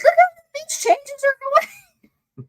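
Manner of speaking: voicing imagined watchers in a jokey high voice; imitating the watchers. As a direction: squeak it out like nosy people behind the mirror. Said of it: "like, like".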